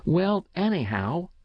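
put-on high-pitched, mildly defiant child; stout boyish resolve. A narrator's reading. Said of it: "he said stoutly".

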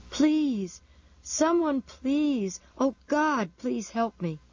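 thin, high plea full of anguish; anguished pleading. A narrator's reading. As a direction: beg desperately, voice pitched high. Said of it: "with a thin note of anguish".

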